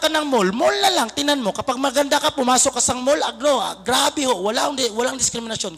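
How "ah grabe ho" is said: ordinary delivery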